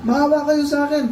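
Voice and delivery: high, imploring plea; acted high-pitched supplication; begging for mercy. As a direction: plead like a beggar calling to the Lord. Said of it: "humingi siya ng awa".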